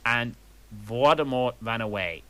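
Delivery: usual speaking voice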